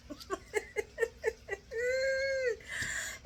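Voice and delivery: high, soft drawn-out giggle; real amused titter; laughing. Real, not acted. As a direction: giggle in little pulses, then trail out high. Laughs at butler turning cook.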